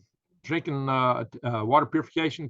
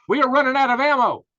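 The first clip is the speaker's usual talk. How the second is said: much higher and louder, sounding the alarm; alarmed outburst; 13 semitones higher, 9 dB louder than usual. Real, not acted. Vlogger warning viewers about ammo, alarmed shout. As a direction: blurt it out like a warning siren.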